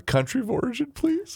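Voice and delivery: high-pitched, soft pretend contestant; put-on high questioning lilt; mock spelling-bee contestant. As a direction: play a contestant asking in a tiny high voice. Playing spelling bee contestant asking.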